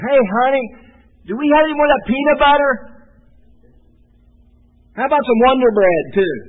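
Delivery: high, loud and fast mock husband; whiny husband voice. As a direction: ham up a husband begging for snacks. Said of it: "They sound like that?".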